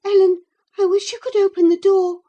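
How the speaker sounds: high, quick, urgent plea; mildly anxious. A narrator's reading. Young companion begging Ellen to open the door, anxious. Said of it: "whispered back my companion anxiously".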